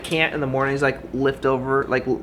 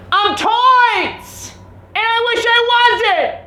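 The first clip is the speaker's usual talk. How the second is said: very high, loud, slow squealed cry; falsetto shriek; 7 dB louder, much slower than usual. Pretend. Vlogger squeals about his ruined leg in falsetto.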